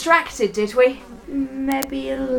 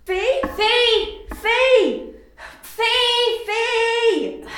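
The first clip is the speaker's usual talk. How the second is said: calling out; 11 semitones higher, much slower than usual. Acted. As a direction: call his name across the room. Calling out in a big room.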